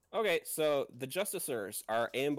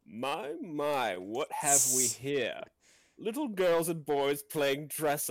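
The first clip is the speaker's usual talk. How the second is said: villain voice; slower than usual. Acted. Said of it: "Dark Lord floats into view".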